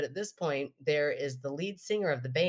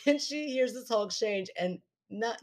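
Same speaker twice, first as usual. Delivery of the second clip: ordinary talk, nothing marked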